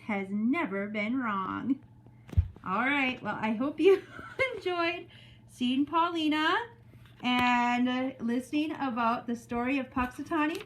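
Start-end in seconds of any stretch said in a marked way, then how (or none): none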